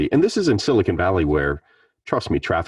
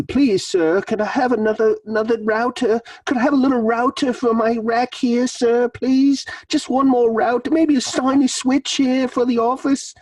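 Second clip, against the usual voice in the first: put-on much higher, pleading English accent like a poor orphan; English accent, Oliver Twist. Team begging the boss for a router, high pleading English accent. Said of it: "that's my best English accent".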